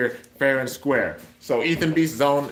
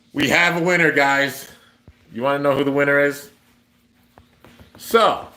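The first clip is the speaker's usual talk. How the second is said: excited announcement; 3 semitones higher, 5 dB louder than usual. Real, not acted. Announcing the giveaway winner.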